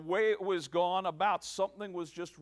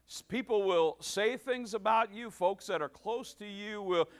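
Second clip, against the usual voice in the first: louder, higher sermon voice, building; fervent preacher rallying the congregation; preaching at full force; 4 semitones higher, 5 dB louder than usual. Acted. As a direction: preach it home to the room.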